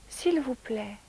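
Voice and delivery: put-on a little girl's soft, shy please; tiny soft voice. A narrator's reading. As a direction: ask it sweetly in a tiny voice. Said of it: "avec une toute petite voix très douce".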